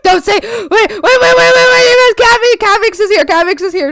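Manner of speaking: high, loud rapid alarm; flustered hurry; excited panic. Real, not acted. Streamer warning chat a guest arrived, excited.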